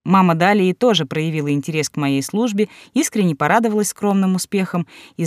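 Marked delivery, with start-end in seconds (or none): none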